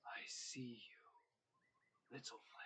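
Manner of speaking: whisper. Acted. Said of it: "a voice say".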